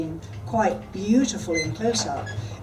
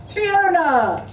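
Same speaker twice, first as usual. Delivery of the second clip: high, loud surprised call of her name; exclamation; 9 semitones higher, 5 dB louder than usual. A narrator's reading. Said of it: "exclaimed Ellie's voice".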